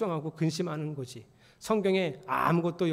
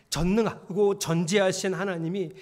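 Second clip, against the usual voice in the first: ordinary talk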